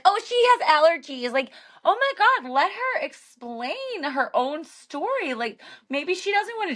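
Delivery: high-pitched exasperated protest; exasperated retelling. Retold or imitated. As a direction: retell it high, dragging out the final plea. Retelling dinner row, exasperated at interruption.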